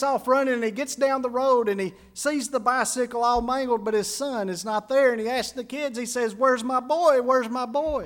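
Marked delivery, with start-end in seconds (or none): 6.3-8.0 a mildly panicked father asking twice; worried, pressing for an answer; frantic father. Retold or imitated. Father frantic about his son.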